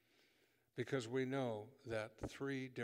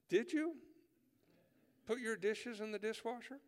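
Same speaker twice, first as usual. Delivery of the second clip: pitched way up, pointed and insistent; repeated question, higher; 10 semitones higher, 4 dB louder than usual. Acted. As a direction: ask it again, much higher and pushier. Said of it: "they look at you and go".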